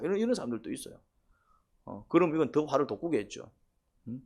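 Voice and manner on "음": ordinary talk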